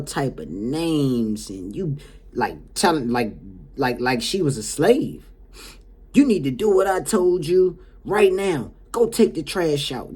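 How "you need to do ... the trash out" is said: higher, louder bossy client; acted-out scolding command; imitated harsh ordering. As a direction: bark orders like mocking a demanding client. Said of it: "That's how she talking to the caregiver".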